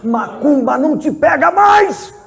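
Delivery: high, loud and slow, like a defeated demon; demon's loud cry of defeat; shouted demon voice. Acted. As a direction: bellow it out as the beaten spirit. Demons defeated, preacher voices them.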